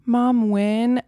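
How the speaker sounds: usual speaking voice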